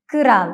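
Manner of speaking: "കിറാൽ" with a short vowel between k and r; Turkish pronunciation. Shown as a demonstration. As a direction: say "കിറാൽ" as kı-ral. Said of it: "കിറാൽ എന്നാണ് ഉച്ചരിക്കേണ്ടത്".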